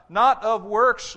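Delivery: not of works drawn out slow and loud; slow, loud stress. Acted. Preacher drives home to congregation, slow and loud. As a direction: lean hard on not of works. Preacher hammers scripture point.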